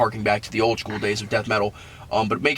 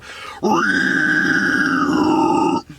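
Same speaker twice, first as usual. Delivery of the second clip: false chord squeal; 3 semitones higher than usual. Shown as a demonstration. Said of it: "do it false chord where you go".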